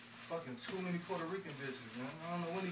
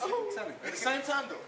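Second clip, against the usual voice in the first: much higher, louder, laughing hype; hyped laughing; 10 semitones higher, 7 dB louder than usual. Acted. Friend hypes up the boast, high, loud and laughing. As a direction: crow about it through laughter.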